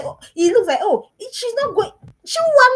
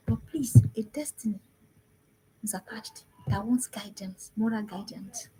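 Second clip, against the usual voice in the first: whisper; 3 semitones lower, 7 dB quieter than usual. Real, not acted. Urgent plea to parents.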